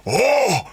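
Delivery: high, swooping "oh!"; big theatrical hoot; Al Pacino outburst. Retold or imitated. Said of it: "starts to get on his, like".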